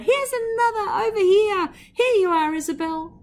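put-on high, loud call to the child; cheerful cry. A narrator's reading. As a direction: throw it out across the garden party. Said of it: "had cried out".

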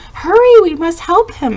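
high, loud acted plea for help; urgent cry. A narrator's reading. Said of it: "he cried".

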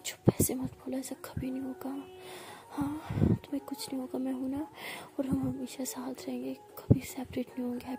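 soft, half-whispered romantic bedtime story; hushed storytelling. A narrator's reading. Narrating the lovers' night scene in a hushed voice.

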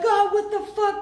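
high, loud, shocked outburst; imitated outraged shock. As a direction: squawk it like they can't believe it. Women react to Nick's rescue.

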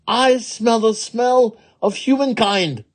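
high, strained demon cry; crying wicked Oni. Acted. Wicked Oni sniffing out a human, high crying out. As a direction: announce the scent in a high cry. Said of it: "cried the wicked Oni".

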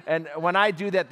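normal talking voice

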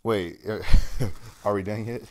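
panting between words, out of breath; winded and puffing after the effort. Real, not acted. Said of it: "I'm out of breath".

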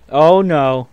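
loud, mock-alarmed parent protest; alarmed outcry. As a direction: cry out loudly to stop the kid. Parent reacts to threatened file cabinets.

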